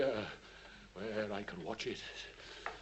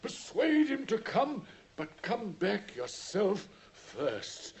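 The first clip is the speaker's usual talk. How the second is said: urgent command; 9 semitones higher, 8 dB louder, slower than usual. Acted. Holmes sharply correcting Watson.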